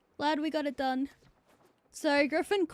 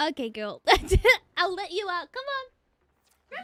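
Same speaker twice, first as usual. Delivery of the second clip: high coaxing pet voice, rising to the top on come on; talking to the dog; 6 semitones higher, 6 dB louder, slower than usual. Real, not acted. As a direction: coax the dog out sweetly. Letting the crying dog out.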